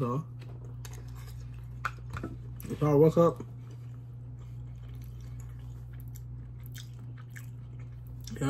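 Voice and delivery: talking while chewing; speaking around food; mouth full. Real, not acted.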